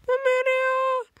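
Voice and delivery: put-on very high, cutesy but creepy girl; sugary high squeal into an eerie whisper; Amelia's creepy adorable voice. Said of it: "sounds like a creepy, adorable voice".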